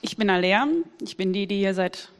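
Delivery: normal talking voice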